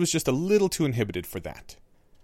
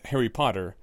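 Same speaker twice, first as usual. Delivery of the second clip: ordinary delivery, nothing marked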